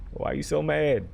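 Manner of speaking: playful complaint, laugh held back; mock protest. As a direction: plead with an angry grandma, jokingly. Repeats the protest without the laugh.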